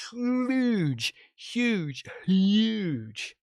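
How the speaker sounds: HUGE stretched long and high, falling away; huge, huuuge, huuuuge; drawn-out emphasis. Real, not acted. Hammering a point with repetition.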